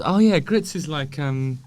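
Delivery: ordinary talk, nothing marked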